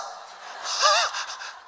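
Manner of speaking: a breathy, high burst of laughter. Real, not acted. Preacher laughing at his own joke about the rumor, high breathy laugh.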